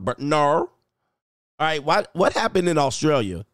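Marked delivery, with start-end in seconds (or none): 0.2-0.4 mock Aussie no, rhotic ending; shaky Australian accent. Retrying the Australian no, imperfect imitated accent. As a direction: say no as "narr" in rough Australian. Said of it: "my Australian accent needs some work".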